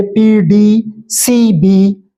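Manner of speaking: drawn-out letter names, one by one; spelling letters out slowly. Shown as a demonstration. Teacher to students, spelling consonants slowly. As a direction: read each letter slowly for the class. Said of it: "यहाँ देखिए consonant रखे हुए थे".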